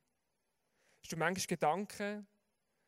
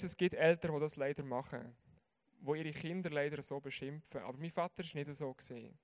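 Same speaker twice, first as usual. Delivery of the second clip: normal talking voice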